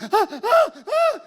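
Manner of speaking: high, loud stammering yelps; hammed-up breathless panic; mock panicked gasps. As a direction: still shrieking, can't get words out. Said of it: "I'm like".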